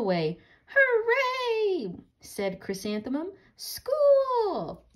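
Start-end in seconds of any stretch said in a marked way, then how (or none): 0.7-1.9 very high, louder cheer; excited cheer. Acted. Girl running to her first school day, high excited cheer. 3.6-4.8 very high, louder cheer; excited cheer. Acted. Girl running to her first school day, high excited cheer.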